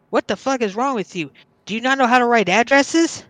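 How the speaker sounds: teacher yelling at student mid-test, angry. Retold or imitated. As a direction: shout at the kid like a furious teacher. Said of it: "she started just going off on me".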